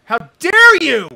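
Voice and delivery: screaming, very high and very loud; shaking with anger. Real, not acted. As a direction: scream it with full outrage. Repeated even louder.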